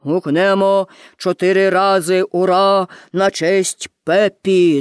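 low, slow, measured proclamation; fire chief's proposal. A narrator's reading. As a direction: propose a cheer as a solemn official. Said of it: "запропонував начальник пожежників".

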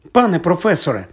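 high, alarmed call; nearly gasping. A narrator's reading. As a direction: blurt it out high, almost out of breath. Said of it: "мало не задихнувся Гаррі".

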